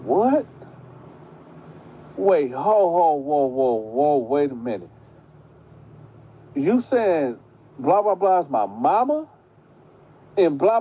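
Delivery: high, reeling confusion; the girl learning her real parents, high and stunned; stunned disbelief. Retold or imitated. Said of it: "So she like".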